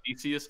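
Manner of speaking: Theseus as "THEE-see-us", rising at the end; questioning repeat. Real, not acted. Host checking the corrected Greek name, questioning repeat.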